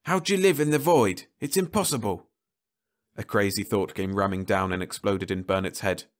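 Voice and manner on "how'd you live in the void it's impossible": higher, louder bitter question; bitter demand. Acted. Rice confronting the living Leffler, bitter demand. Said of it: "he demanded bitterly".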